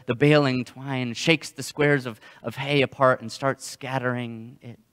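ordinary delivery

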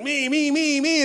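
high, droning mock of a self-centred person; prideful self-absorption. Preacher imitating someone prideful, self-absorbed mock voice. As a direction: imitate a whiny me-me-me, pitched high. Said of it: "see somebody being prideful".

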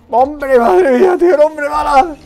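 yelling high and loud with glee; ecstatic shout. Real, not acted. Gamer shouting thrilled at the human cannonball.

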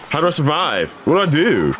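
high-pitched, flustered and terrified; real panic. Real, not acted. Terrified player not knowing what to do in the ritual game, panicked. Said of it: "I'm fucking terrified".

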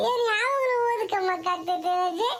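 tearful, broken-up whimpering; acted sobbing. Boy pleads with grandmother about studying, sobbing. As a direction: sob between the words, then plead. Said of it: "ಅಳ್ಬೇಡ ಸುಮ್ಕಿರಪ್ಪ".

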